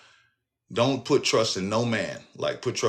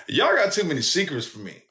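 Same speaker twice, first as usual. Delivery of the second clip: higher, more fed-up second take; exasperated repeat; 9 semitones higher than usual. Retold or imitated. Son to mama's family, repeating it more exasperated. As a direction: say it again, more worn out by them. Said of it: "repeated line".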